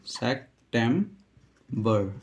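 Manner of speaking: सितंबर very slowly as English "sek ... tem ... ber"; syllable by syllable. Shown as a demonstration. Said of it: "इसको कैसे pronounce करते हैं".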